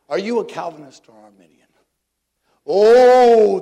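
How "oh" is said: loud high mocking "oh"; mock gasp. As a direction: throw out a big sarcastic exclamation. Mock reaction to theology question.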